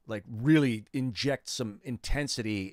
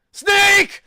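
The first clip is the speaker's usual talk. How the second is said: huge, far higher scream of a name; anguished shout; 19 dB louder than usual. Shown as a demonstration. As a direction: bellow it like a game hero in agony. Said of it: "voices of a guy getting hit".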